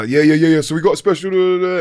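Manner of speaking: high, excited radio host hype. Retold or imitated. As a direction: hype up the caller like a DJ. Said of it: "And it was Ted doing it".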